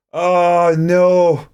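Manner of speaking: high drawn-out oh no of disappointment; crushed after the miss; dismayed groan. Real, not acted. Groan at missed chance.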